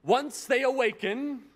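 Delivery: put-on slow, grand English accent, the last line drawn out; Churchill impression. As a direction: play a lightly voiced Churchill, calm and sure. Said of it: "He says".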